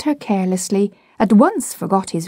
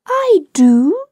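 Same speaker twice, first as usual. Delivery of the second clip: mother's reply; 11 semitones higher, 5 dB louder than usual. A narrator's reading. Mother comforting Michael.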